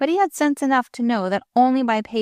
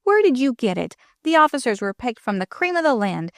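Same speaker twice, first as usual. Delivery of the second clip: raised-pitch rude challenge; mildly angry, higher-pitched retort; 6 semitones higher than usual. A narrator's reading. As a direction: challenge them rudely, indignantly. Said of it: "he said rudely".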